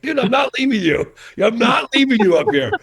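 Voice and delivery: retelling his own dramatic refusal to his exhausted friend; heroic refusal. Retold or imitated. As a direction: refuse like an action-movie hero. Refusing to abandon friend, movie scene.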